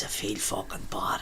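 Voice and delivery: slow, whispered confession; imitating a drunk passenger's quiet confession; whispered guilt. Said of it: "and he was getting louder".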